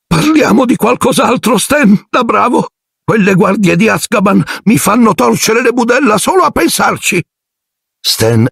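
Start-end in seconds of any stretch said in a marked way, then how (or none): 0.1-7.2 very high put-on character voice; uneasy driver. A narrator's reading. Ern shuddering at the Azkaban guards, uneasy character voice. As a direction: steer off the subject, high and uneasy.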